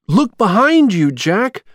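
high, loud, urgent call; shouted warning. A narrator's reading. Sally warning Jack of flying knives, urgent shout. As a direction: shout to alert someone in danger. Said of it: "shouted Sally".

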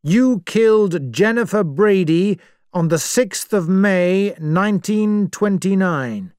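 cold voice from a gramophone naming the guilty; accusing voice. Acted. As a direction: pronounce each crime like a sentence from the bench. Accusing voice from the record.

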